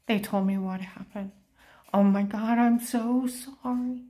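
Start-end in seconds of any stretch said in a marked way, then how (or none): 1.9-4.0 louder, slow and pleading, near tears; crying apology. Retold or imitated. Retold man apologizing, pleading near tears. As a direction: beg forgiveness as if about to sob. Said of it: "it sounded like he was crying his eyes out".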